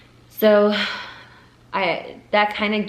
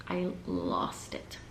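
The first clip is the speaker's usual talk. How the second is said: breaking down; 11 semitones lower, 9 dB quieter, much slower than usual. Real, not acted. Telling viewers how she broke down, tearful whisper.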